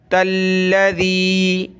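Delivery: Quran recitation. Shown as a demonstration. Teacher recites the verse slowly for pupils. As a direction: intone it slowly like tilawat.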